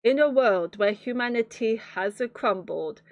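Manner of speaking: ordinary talk, nothing marked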